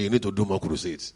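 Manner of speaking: normal talking voice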